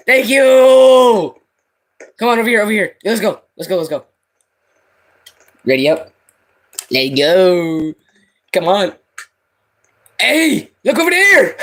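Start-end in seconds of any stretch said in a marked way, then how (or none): 0.1-1.4 calling from far away. Real, not acted. Calling to teammate in game. 10.2-11.6 calling from far away. Real, not acted. Calling to another player.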